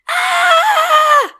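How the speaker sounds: high piercing shriek of fright; startled pony shriek. Acted.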